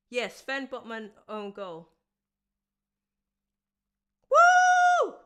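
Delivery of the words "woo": huge joyful yell, much higher and louder; real shout. Real, not acted. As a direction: roar it out for the goal. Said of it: "Sorry if I'm shouting".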